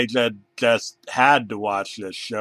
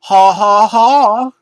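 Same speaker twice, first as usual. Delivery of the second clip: put-on high, much louder English-accented cackle; mocking banana laugh; 11 semitones higher, 13 dB louder, much slower than usual. Banana mocking the bear with a loud English laugh. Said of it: "the banana laughed in the English accent".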